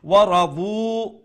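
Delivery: slow, loud "ورضوا" with heavy rounded r and long final u; heavy ra, drawn-out ending in "ورضوا"; full-mouthed ra. Shown as a demonstration. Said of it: "ra, full mouth".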